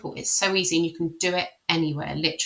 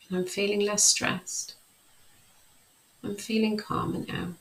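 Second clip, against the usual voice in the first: calm, measured self-talk while tapping. Shown as a demonstration. Coach demonstrating tapping, calm affirmation voice. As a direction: recite the tapping phrases steadily, soothing yourself. EFT tapping demo script.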